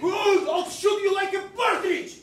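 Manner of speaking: angry threat. Acted. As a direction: bellow the threat at him. Threatening rival in quarrel.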